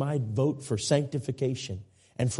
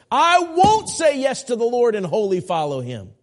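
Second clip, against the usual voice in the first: much higher and louder, a strained defiant voice; mock defiant refusal; 11 semitones higher, 8 dB louder than usual. Preacher voicing a stubborn church member refusing God, loud mock defiance. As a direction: play the stubborn hearer shouting his refusal.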